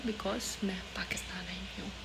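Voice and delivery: drops to a secretive whisper; whispered aside. Real, not acted. Telling her viewers why the vlogs are late, whispering. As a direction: let it slip like a little secret.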